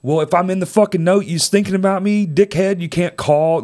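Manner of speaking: acted-out exasperated challenge; indignant retort. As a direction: throw it at him, aggrieved. Angry imagined retort to the dead man.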